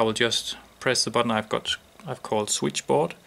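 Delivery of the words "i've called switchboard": ordinary talk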